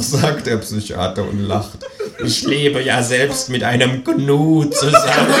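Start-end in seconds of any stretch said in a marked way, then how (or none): 2.2-5.3 slow, chuckling doctor voice; a shrink laughing along, drawn out; amused psychiatrist. A narrator's reading. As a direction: psychiatrist humouring his patient, slow and chuckling.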